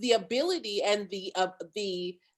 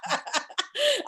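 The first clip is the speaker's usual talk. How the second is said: high burst of laughter; bright laugh at herself; 12 semitones higher than usual. Real, not acted. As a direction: let a big laugh out.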